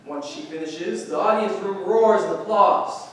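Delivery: normal talking voice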